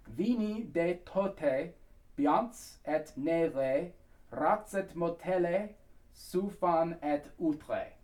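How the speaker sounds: very slow, separated foreign syllables; pronunciation demo. Shown as a demonstration. Coach repeating the lyric for singers, slow and careful. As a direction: spell out "Vini de tote" phrase by phrase, slowly. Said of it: "So one more time".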